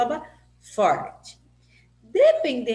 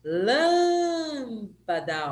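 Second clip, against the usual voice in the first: LÂM in "Lâmpada" very long and raised; "Lâmpada" with a greatly stretched LÂM; stress on LÂM; 3 semitones higher, much slower than usual. Shown as a demonstration. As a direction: slowly call "Lâmpada", holding the first syllable. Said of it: "antepenúltima sílaba forte".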